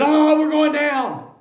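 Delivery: high, slow mock panic on the plane; aunt on a bumpy plane, hammed-up high fearful wail; panicked aunt. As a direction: imitate the aunt wailing in turbulence. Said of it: "she said".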